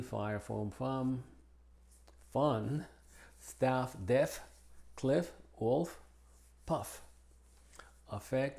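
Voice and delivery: single F in face, staff, buffet, as in Spanish; F and FF said as one F; English F. Shown as a demonstration. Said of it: "se pronuncia como una F sencilla". (F sencilla)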